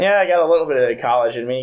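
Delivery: blurted out very fast; rushed reply. Retold or imitated. Narrator answering the cop, very fast. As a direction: rattle it off sheepishly. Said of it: "And I went".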